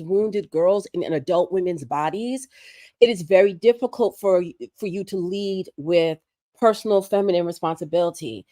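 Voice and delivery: ordinary talk, nothing marked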